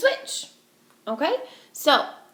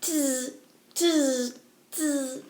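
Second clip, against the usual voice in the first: monkey chatter; 3 semitones higher, 3 dB quieter, much slower than usual. Acted. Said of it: "shook both their hands back at him and said".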